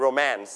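"romance" with the second syllable stressed, roMANCE. Shown as a demonstration. Teacher repeats the correct reading of "romance", second syllable stressed. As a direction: land firmly on MANCE in "romance". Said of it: "อ่านว่า romance".

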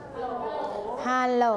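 soft, very drawn-out phone hello; phone pickup demo. Shown as a demonstration. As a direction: answer the phone lazily. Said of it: "เรารับแล้วเราก็พูดว่า".

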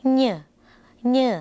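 เหน่อ with a slightly nasal onset; nasal sound. Shown as a demonstration. Teacher showing viewers the letter's sound, nasal เหน่อ. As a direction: sound out เหน่อ lightly through the nose. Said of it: "ออกเสียงเป็น".

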